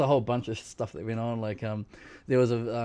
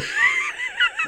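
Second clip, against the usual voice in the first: shrieking laugh; 8 dB louder than usual. Real, not acted. Shocked by docking.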